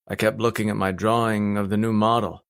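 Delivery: usual speaking voice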